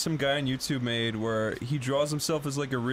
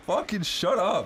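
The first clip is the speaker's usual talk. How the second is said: laughing retort; 5 semitones higher than usual. Real, not acted. Mocking the video.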